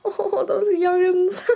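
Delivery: put-on joking about becoming an old woman; old person. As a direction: crank it up like a fussy granny. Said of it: "I'll be like".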